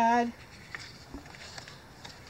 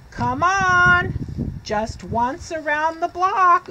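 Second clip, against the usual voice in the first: higher, louder pleading voice; begging pigeon; 6 semitones higher, 3 dB louder than usual. Acted. Pigeon begs the reader to let him drive, pleading. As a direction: plead like a pigeon that wants the bus. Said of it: "he's begging".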